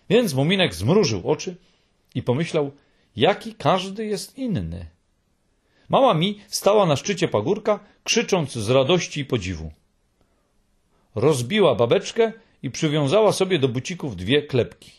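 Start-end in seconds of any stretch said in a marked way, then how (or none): none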